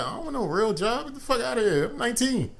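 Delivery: mock teen. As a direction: brush them off like a teen. Retelling teen self.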